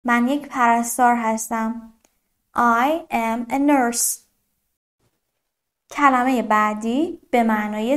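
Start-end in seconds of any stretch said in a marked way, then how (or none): 2.6-4.2 very slow, separated English words; careful teacher's slow demonstration; slow model sentence. Shown as a demonstration. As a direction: model it word by word for learners.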